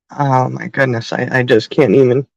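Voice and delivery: low, choked-up voice fighting tears; holding back tears. Real, not acted. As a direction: voice sinking as tears come. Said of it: "I'm trying not to cry".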